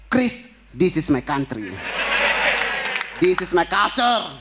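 lightly voiced foreigner throwing the line back; mocking Chris. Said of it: "Dia marah, dia bilang". (meniru Chris dengan suara rendah dan lambat)

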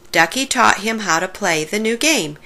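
ordinary delivery, nothing marked

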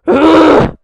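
very loud, high growl of exasperation; frustrated growl. A narrator's reading. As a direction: let out a furious wordless grr. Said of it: "growled Mister Grumble".

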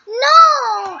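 high, loud defiant no; high loud flat refusal; shouted refusal. Acted. Refusing the bully's order.

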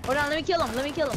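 hyped and loud; pumped up mid-fight; excited. Real, not acted. Mid-fight, excited about getting a kill.

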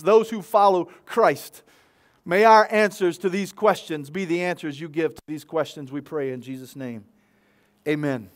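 measured, earnest public prayer. Real, not acted. Pastor praying to God for help with the questions, prayer. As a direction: lead the room in prayer, unhurried.

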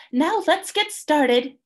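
high, slow and bossy; bossy Emu. A narrator's reading. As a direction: take charge of the rehearsal. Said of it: "Emu was bossing and fussing".

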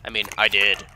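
ordinary talk, nothing marked

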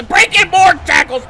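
loud, rising disbelief at the run; loud incredulous play call; excited outcry. Real, not acted. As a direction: yell it out as the tackles break. Opponent keeps breaking tackles.

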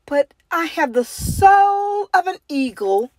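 high voice, rising on soul; a small bird with big dreams; dreamy penguin. A narrator's reading. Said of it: "said Penguin".

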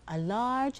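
ordinary delivery, nothing marked

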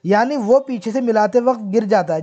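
usual speaking voice

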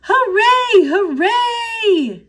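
high, loud whoop of joy; cheering class. A narrator's reading. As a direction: cheer like a whole class of excited kids.

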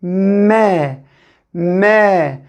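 Me said as one smooth syllable, M running straight into E; blended syllable me. Shown as a demonstration. Teacher blending M and E for young pupils, slowly. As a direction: glide M into E without a break. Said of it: "Yapıştıracağız".

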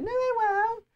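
squeaky high mimicry of her sister; high mock voice. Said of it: "You're like".

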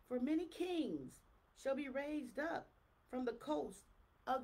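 slow, weighty preaching read; scripture reading. Acted. Preacher reading prophecy to the congregation, slow and solemn. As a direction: proclaim the verse slowly and gravely.

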